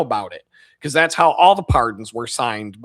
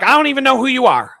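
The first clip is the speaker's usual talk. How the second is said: put-on high, loud, fast old lady; high loud fast elderly lady; confused old woman; 10 semitones higher, 6 dB louder than usual. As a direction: rattle it off like a bewildered grandma. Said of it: "she'd be like".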